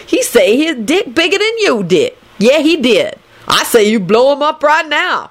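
high, crowing smug boast. A narrator's reading. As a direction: gloat in a high, put-on character voice. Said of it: "Lucretia told Lupin smugly".

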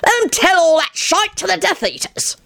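gloomy Dillwiggle voice; grumbling character voice. A narrator's reading.

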